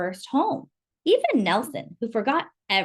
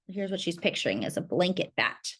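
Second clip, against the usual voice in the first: ordinary delivery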